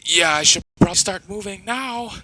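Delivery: nervous yelp after hearing a roar; panicked. Acted. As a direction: scramble to get moving, scared. Roar heard in the distance.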